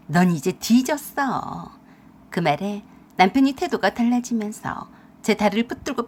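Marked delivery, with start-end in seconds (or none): 0.1-1.7 slow, low menacing threat. Acted. Wife threatening husband, slow and menacing. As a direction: drag out each word like a verdict.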